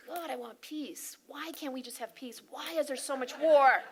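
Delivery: mock exasperated plea rising to a loud cry; pleading that swells into a loud shout; frustrated pleading. Voicing the angry peace-seeker, exasperated plea building loud.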